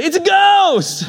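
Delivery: high, slow, laughing ghost shriek; mock terrified shout. Preacher acting the disciples seeing Jesus, mock terrified shriek. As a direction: play the scared disciples, half laughing. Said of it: "what did they say".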